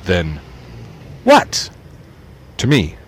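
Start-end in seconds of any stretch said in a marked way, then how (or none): 1.2-1.7 much higher, louder call from across the room; far-off voice. Acted. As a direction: someone far away shouting. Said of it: "Another voice, far away".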